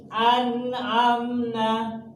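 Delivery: slow, high recited "an'amna", no nasal hum; clear n. Shown as a demonstration. Teacher showing nun meeting ain, clearly recited. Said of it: "Bacanya jelas".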